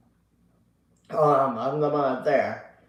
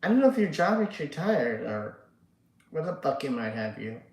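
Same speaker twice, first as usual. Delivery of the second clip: usual speaking voice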